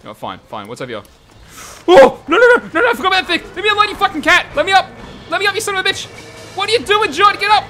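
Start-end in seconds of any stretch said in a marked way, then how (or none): 1.9-7.6 loud, high, frantic cries while being mauled; panicked yelling. Real, not acted. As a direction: scream at the creature to get off. Attacked by cat, panicking.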